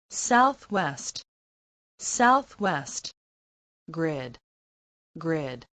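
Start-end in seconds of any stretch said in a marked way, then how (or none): none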